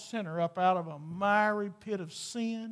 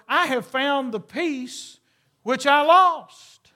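joyful woman calling friends; 6 semitones higher, 10 dB louder, slower than usual. Acted. Preacher voicing rejoicing woman.

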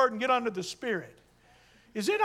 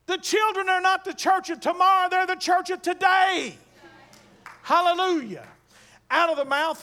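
high, loud preacher's call; preaching at full force; 7 semitones higher, 6 dB louder than usual. Acted. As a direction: rouse the sleepy congregation. Preacher exhorting congregation.

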